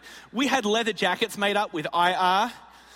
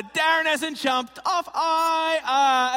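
higher, slow, louder mock taunt; even louder; 7 semitones higher, 4 dB louder, much slower than usual. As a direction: stretch it out loud to shame him. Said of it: "She said even louder".

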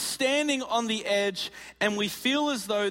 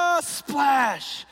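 falling yell; 5 semitones higher than usual. Retold or imitated. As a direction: yell as you drop. His own jump off the rock.

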